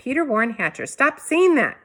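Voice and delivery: full-name scold; hollered. A narrator's reading. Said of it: "my mom hollered".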